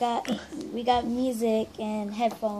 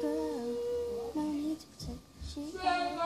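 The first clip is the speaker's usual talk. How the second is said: higher, softer whisper; leaning in to whisper; 7 semitones higher, 10 dB quieter than usual. Real, not acted. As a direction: mouth it in a hushed whisper. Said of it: "we have to whisper".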